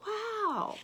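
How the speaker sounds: high, amazed wow; wow of surprise. Real, not acted. Teacher marvels at the uncle's help, amazed.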